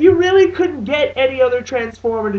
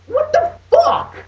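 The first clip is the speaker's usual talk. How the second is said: incredulous outburst; slower than usual. Real, not acted. Outraged at the movie.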